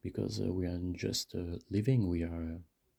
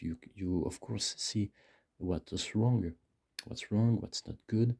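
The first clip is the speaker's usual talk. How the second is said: usual speaking voice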